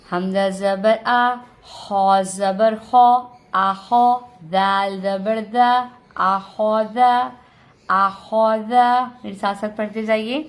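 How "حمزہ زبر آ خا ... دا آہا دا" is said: slow, loud spelling with a full, rounded خا; heavy kha. Shown as a demonstration. Teacher drills the word for learners, خا said heavy and slow. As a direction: sound خا thick and heavy, syllable by syllable. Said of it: "خا کو موٹا پڑھا تھا".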